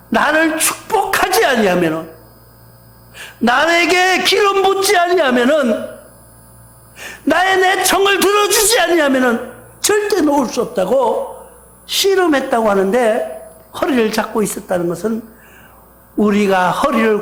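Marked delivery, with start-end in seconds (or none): 0.1-11.4 raised, forceful refusal to let go; Jacob's urgent, dogged demand for blessing; insistent plea. Acted. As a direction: plead higher and higher, holding on stubbornly. Jacob refuses to release the angel.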